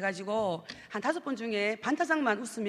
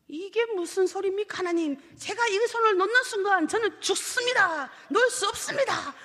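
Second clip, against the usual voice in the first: panicked refusal; 7 semitones higher than usual. Retold or imitated. Man refuses to let go.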